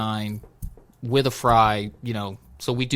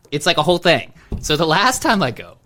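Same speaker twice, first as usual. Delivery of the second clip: ordinary delivery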